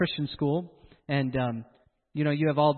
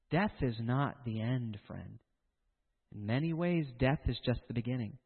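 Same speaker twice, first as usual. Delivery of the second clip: hushed solemn warning; 6 semitones lower, 10 dB quieter than usual. Real, not acted. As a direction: drop to a grave near-whisper.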